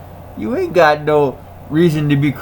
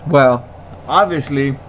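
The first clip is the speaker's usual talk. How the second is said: ordinary talk, nothing marked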